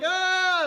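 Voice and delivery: high, drawn-out acted shriek; crowd's mock scream. Everybody seeing the problems escape, screaming. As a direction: imitate a panicked crowd screaming.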